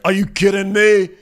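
high, loud indignant cry; mock outrage. Friend about to storm off to her room, mock outraged. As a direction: burst out as if appalled, played up. Acting Gabby upset, storms off.

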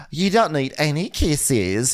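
mildly mocking Natalia. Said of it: "And Natalia's like".

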